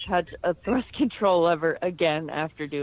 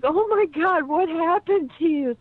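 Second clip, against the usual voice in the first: shocked coworker; 11 semitones higher, 4 dB louder than usual. Retold or imitated. Coworker voiced in a story.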